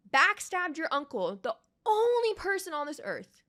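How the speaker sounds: furious lecture to a character; angry scolding. Real, not acted. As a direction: hammer home the betrayal. Scolding Zuko directly.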